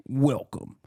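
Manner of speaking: lightly imitated announcer welcome. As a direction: echo the announcer call. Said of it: "announcer voice".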